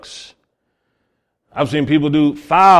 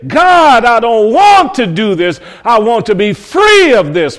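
raised, pleading prayer, near a cry; pleading cry to God; 6 semitones higher, 5 dB louder than usual. Acted. Sinner cries out to God to be free, pleading. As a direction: beg God to set you free.

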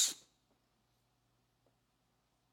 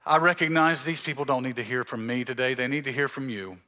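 prayer; 5 semitones lower, 4 dB quieter than usual. Acted. Opening prayer before sermon.

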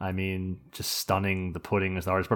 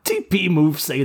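slow, lightly voiced comic impression; Bill Cosby; 7 semitones higher, 6 dB louder, slower than usual. Podcaster twisting a catchphrase for the cohost, mild celebrity impression. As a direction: draw it out like a parodied TV entertainer. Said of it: "didn't think Bill Cosby and my bad voices".

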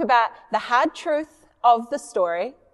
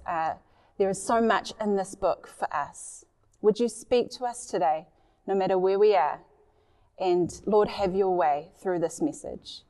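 prayer; 4 semitones lower than usual. Real, not acted. Leads a prayer.

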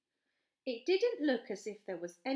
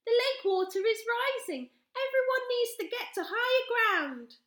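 put-on shouting girl; 9 dB louder than usual. A narrator's reading. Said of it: "shouted Charlie".

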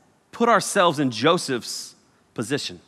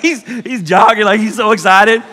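ordinary talk

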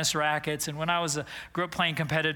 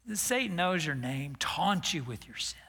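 much softer, ending in a whisper; softened refrain; 4 semitones lower, 6 dB quieter than usual. Shown as a demonstration. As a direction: draw the crowd in with a hush. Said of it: "refrain repeated, dropped to hush".

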